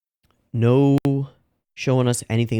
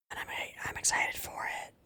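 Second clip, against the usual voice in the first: unvoiced, hushed confession of excitement; excited whisper; 14 dB quieter than usual. Real, not acted. Vlogger whispering to viewers how excited he is about the story. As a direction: whisper it like a thrilled secret. Excited aside after dramatic buildup.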